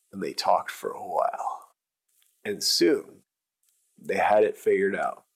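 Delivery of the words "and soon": usual speaking voice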